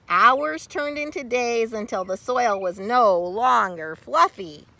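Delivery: ordinary delivery, nothing marked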